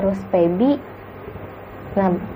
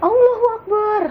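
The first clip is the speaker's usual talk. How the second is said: loud, much higher startled cry of faith; frightened exclamation; 13 semitones higher, 3 dB louder than usual. Retold or imitated. Rijal crying out in fear at the ghost, loud high exclamation. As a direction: cry it out in sudden terror. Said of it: "katanya gitu".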